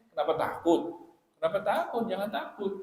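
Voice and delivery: emphatic gentle reassurance; reassuring. Retold or imitated. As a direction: urge her not to be afraid. Preacher reassuring, voicing Prophet.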